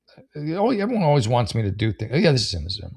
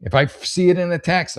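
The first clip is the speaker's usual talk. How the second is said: usual speaking voice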